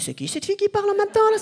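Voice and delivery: high-pitched mock girlish voice; unmasculine voice. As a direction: squeak it up high, mocking your own voice. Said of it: "J'ai pas une voix très, euh, masculine".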